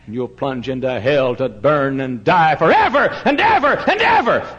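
high, loud warning from the pulpit; preaching at full force. Acted. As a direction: thunder at the scoffers.

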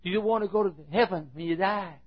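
high lisping question; imitated speech impediment. Church nut asking banker about heaven, high lisping voice. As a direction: put on an earnest lisping voice. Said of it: "he, he, he, he talked like this".

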